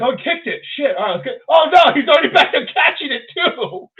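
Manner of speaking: high, loud, mounting wail of dismay; mock panic. Host mimics Scotland players chasing the French winger, mock panic.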